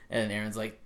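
normal talking voice

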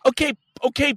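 normal talking voice

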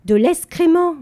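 loud, high, forceful repeat. A narrator's reading. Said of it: "avec plus d'énergie".

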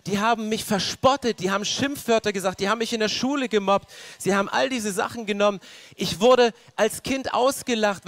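loud, rising sermon lament; preaching at full force. Acted. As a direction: pound out each grief to the congregation. (laute, eindringliche Predigt)

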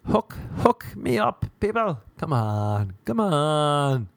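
drawn-out begging wail; mock pleading. Comedian convincing a girl, mock pleading. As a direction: plead with stretched, wailing words. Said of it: "That's me convincing a girl".

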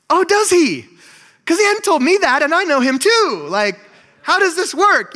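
a pastor playfully doubting a churchgoer's God claim; mock surprise. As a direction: feign amazement that God told them first. Pastor mocks God card claim.

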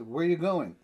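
loud, pointed demand for an answer; insistent asking. Acted. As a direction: press the question hard, third time of asking. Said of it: "if they ask you a third time".

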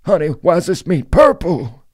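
high, loud grandpa voice; old grandpa voice. Pretend. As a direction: play a confused grandpa at dinner. Voices grandpa asking about meat.

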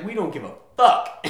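high, loud defiant shout; defiant Austin. Retold or imitated. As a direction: yell it like a rebellious city. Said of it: "the rest of Austin's like".

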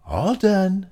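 high little pig voice; Micro Pig. A narrator's reading. Micro Pig reports on his special phone, high voice. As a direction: pitch it up as a tiny character.

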